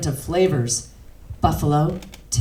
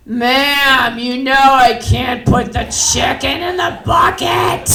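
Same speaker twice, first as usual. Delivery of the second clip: loud, high, drawn-out protest; exasperated shout; 9 semitones higher, 7 dB louder, slower than usual. Acted. Clerk losing it with the customer, exasperated high shout. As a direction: shout it at the end of patience.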